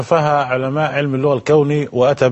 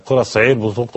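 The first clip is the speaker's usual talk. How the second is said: normal talking voice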